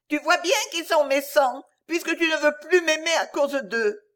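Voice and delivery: put-on high child's voice, lightly tearful; a little girl breaking down, mildly sobbing; bursting into sobs. A narrator's reading. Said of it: "éclatant en sanglots". (en éclatant en sanglots)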